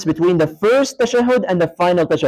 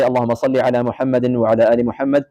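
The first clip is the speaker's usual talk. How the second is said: rapid, lower prayer recitation; fast recitation; 3 semitones lower, much faster than usual. Shown as a demonstration. Teacher showing students the tashahhud, fast recitation. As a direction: rattle off the Arabic like daily prayer.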